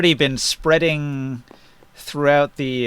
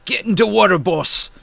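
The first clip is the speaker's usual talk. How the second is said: higher-pitched giant urging; lightly voiced giant raising the alarm; 6 semitones higher than usual. Giant pointing out the fire to another, higher giant voice. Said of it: "and he says".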